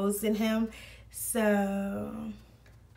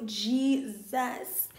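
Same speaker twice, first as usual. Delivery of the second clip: ordinary talk, nothing marked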